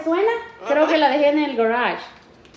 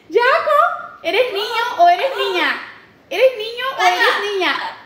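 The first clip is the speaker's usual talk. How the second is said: high, sweet pet-talk; 7 semitones higher than usual. Real, not acted. Owner asking the pet its sex in high pet-talk.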